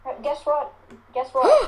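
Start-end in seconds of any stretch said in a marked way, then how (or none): 1.1-1.7 high, loud teasing call; excited. Real, not acted. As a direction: call it out eagerly.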